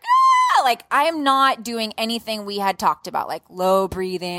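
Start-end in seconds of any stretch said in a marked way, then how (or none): 0.0-0.6 sudden high yelp of pain; mock pain yelp; pained cry. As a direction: cry out as a contraction hits. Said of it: "the pain comes and I'm like".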